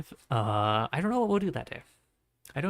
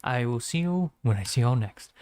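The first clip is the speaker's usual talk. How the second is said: ordinary talk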